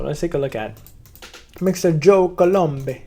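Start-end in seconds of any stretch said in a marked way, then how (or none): 1.6-3.0 higher, louder and slow hammed-up Italian "Mister Joe Colombe"; mock Italian accent. Introducing the founder in a grand playful Italian accent. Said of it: "I don't think he's Italian. I should stop that".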